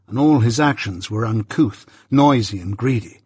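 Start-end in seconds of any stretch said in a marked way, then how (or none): none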